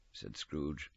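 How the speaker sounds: ordinary delivery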